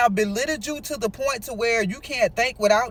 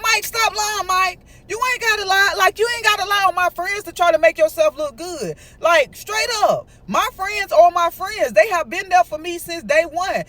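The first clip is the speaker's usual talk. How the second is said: higher, louder, accusing him of lying; accusing retort; 6 semitones higher, 3 dB louder than usual. Retold or imitated. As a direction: throw the accusation back at him, pitched up. Nola confronting Mike, accusing.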